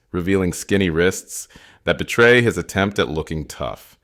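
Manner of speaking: ordinary delivery, nothing marked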